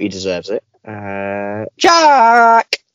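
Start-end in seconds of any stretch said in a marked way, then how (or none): 1.8-2.8 loud, high fanfare introduction; announcer call. Acted. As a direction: call the name like a game show host. Host introducing next guest.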